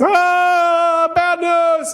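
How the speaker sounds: high, loud alarmed cry; panicked shout. Retold or imitated. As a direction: yelp in sudden alarm. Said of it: "your body goes".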